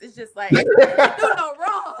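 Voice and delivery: loud, high, laughing praise; real laughing. Real, not acted. Gushing over the way she writes, loudly laughing. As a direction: laugh hard through the words, voice rising.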